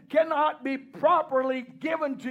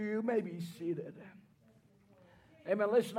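ordinary talk

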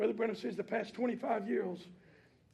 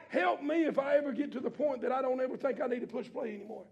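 ordinary talk, nothing marked

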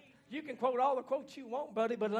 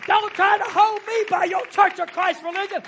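preaching at full force; 9 semitones higher, 16 dB louder than usual. Acted. Preacher shouting freedom to his congregation, full-force preaching. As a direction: lift the church with a rising shout.

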